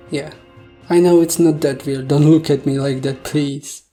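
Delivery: normal talking voice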